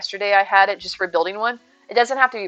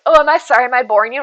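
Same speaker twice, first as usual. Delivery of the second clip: offended stranger; 3 semitones higher, 8 dB louder, faster than usual. Retold or imitated. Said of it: "They're like".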